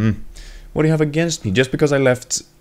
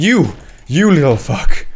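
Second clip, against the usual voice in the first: loud, accusing exclamation; cursing outburst; 5 semitones higher, 6 dB louder than usual. Real, not acted. Gamer rounding on an enemy, angry outburst.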